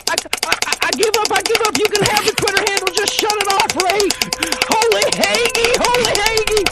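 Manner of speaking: high, hammed-up panicked pleading; begging Ray to shut it off, mock panic. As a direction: beg Ray to switch it off, high and frantic. Exaggerated distress at the machine.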